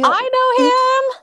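high, loud, drawn-out squeal of recognition; real high-pitched shriek of excitement; excited squeal. Real, not acted. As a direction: squeal with delight, stretching the words. Thrilled at recognizing the friend.

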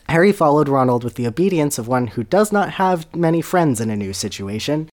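usual speaking voice

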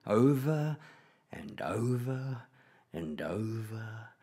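drawn-out words fading into sleep; dozing off. A narrator's reading. Granddad reads a long book, slowing sleepily to nothing.

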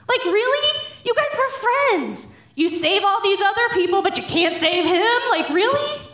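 high, incredulous reproach; indignant acted questioning of a friend; mad. Sisters reproaching Jesus for not saving their brother, mad. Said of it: "They were mad!".

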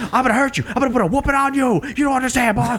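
high, fast boxer trash talk; Clubber Lang. Retold or imitated. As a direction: taunt him like a cocky brawler. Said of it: "I'm Clubber Lang".

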